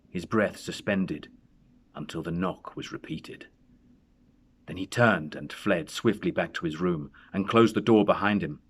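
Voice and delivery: plain reading voice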